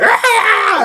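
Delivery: high, loud squealing cheer; rat cheer. Acted. Game master voicing the cheering rats, high squeal.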